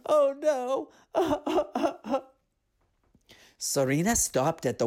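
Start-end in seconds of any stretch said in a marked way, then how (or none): 0.0-0.9 crying. Acted. Character cries out. 1.1-2.2 high laugh. Acted. Laugh after character line.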